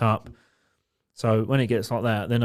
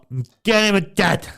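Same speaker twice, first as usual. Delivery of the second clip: put-on higher drunk slur of a mate; mock drunk friend; 9 semitones higher, 4 dB louder than usual. Drunk friend telling the bouncer his lift home, mock drunk. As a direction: play him tipsy.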